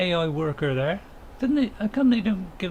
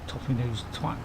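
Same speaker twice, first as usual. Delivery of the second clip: muttered insult; 4 semitones lower, 7 dB quieter than usual. Real, not acted. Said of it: "I may have said toffee nose twat there".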